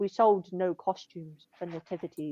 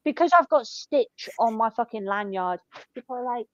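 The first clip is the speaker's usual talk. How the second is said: normal talking voice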